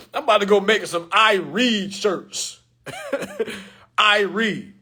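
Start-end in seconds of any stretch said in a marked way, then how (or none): 3.3-4.7 laughing. Real, not acted. Laughing about shirts.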